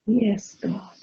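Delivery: fading into a whisper; softly whispered yes to God; quiet prayer. Real, not acted. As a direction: let the prayer drop to a whisper. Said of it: "prayer closing".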